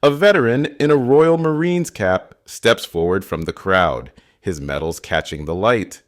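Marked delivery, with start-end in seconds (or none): none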